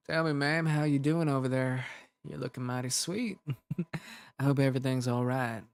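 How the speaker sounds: put-on flirt politely in a Southern cowboy accent, with a chuckle; McCree. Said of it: "we love your Southern voice".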